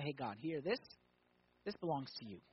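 soft, low offering to God; quiet prayer. Acted. Believer handing the spare margin to God, quiet prayer. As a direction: speak it privately to God, softly.

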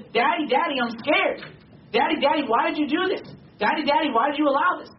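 put-on high-pitched pleading of an upset child; imitating scared child. Preacher acting children running to their father, frightened pleading. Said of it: "Your children are afraid".

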